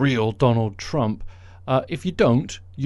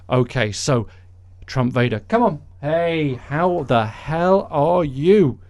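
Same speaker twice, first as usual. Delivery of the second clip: normal talking voice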